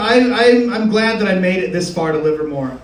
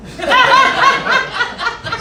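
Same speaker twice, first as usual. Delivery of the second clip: high laugh; 14 semitones higher than usual. Real, not acted. Laughing at own joke.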